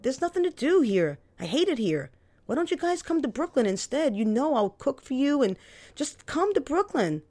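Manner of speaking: whiny plea to come over; pleading brother. Acted. Kevin complaining, pleading.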